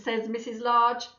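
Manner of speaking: plain reading voice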